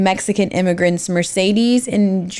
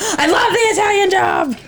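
high-pitched delighted squeal of enthusiasm; excited shout; 12 semitones higher than usual. Real, not acted. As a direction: burst out in a very high fangirl cry. Delighted outburst about a favourite film.

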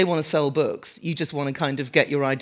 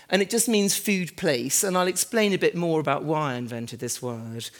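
ordinary talk

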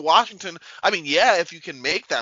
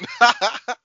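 short laugh; 9 semitones higher, 5 dB louder than usual. Real, not acted. Laughing after joke.

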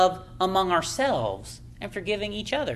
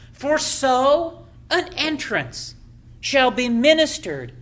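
scripture reading; 3 dB louder, slower than usual. Acted. Reads scripture slowly in sermon.

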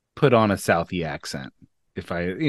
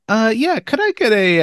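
very high, louder timid ordering voice; voice gone up; 13 semitones higher, 5 dB louder than usual. Retold or imitated. Customer ordering from a Boston bartender, voice gone up high. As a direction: send your own voice way up, imitating yourself. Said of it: "my voice went up".

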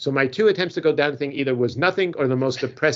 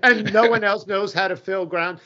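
normal talking voice

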